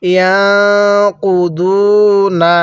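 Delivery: slow drawn-out tajweed recitation; Quranic recitation. Shown as a demonstration. Teacher leading students to repeat, slow recitation. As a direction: stretch each syllable for the class to repeat. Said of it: "এরপরে বলুন".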